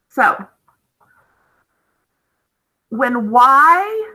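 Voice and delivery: ordinary delivery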